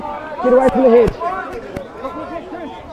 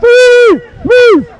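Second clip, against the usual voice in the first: shouted calls; 8 semitones higher, 6 dB louder than usual. Real, not acted. Referee calling tackle counts.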